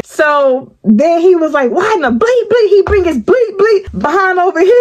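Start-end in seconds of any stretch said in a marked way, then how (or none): 1.7-4.7 much higher, exasperated swearing rant; a zero-tolerance partner losing it; set off, cussing. Retold or imitated. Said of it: "Any little thing will set him off".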